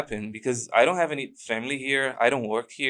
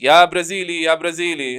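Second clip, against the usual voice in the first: higher-pitched mock hail; imitated calling out; 4 semitones higher than usual. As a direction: hail someone from behind, as the officers did. Officers calling out from behind him.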